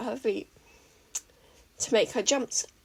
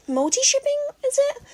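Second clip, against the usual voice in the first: very high, louder lilt; squeaky excited question; 5 dB louder than usual. A narrator's reading. May musing about multi-shipping, squeaky. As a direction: squeal the idea out.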